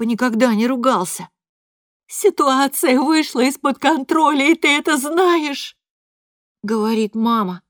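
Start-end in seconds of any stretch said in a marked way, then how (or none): 2.1-5.8 higher, pained thin voice; hurt, no longer shouting; mother to father, thin and pained. A narrator's reading. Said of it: "голос тонок и полон боли".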